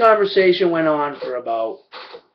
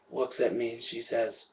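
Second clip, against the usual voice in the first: usual speaking voice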